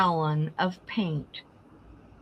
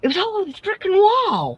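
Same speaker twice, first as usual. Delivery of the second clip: exasperated; 12 semitones higher, 3 dB louder than usual. Real, not acted. Exasperated about paint on the wall.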